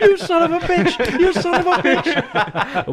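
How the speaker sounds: high-pitched mock yell through laughter, slow; hammed-up laughing yell. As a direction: shriek it while cracking up. Mock yelled quote, laughing.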